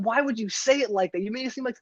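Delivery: high-pitched exasperated retelling; incredulous complaint. Retold or imitated. Retold complaint to dad.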